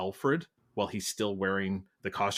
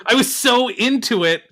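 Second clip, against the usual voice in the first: excited; 12 semitones higher, 11 dB louder than usual. Real, not acted. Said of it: "breaks off laughing".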